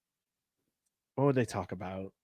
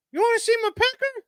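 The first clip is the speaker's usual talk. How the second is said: squeaky high cartoon bird; high-pitched silly bird voice. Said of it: "this little bird who just flies up to this dude and goes".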